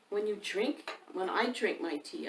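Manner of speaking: usual speaking voice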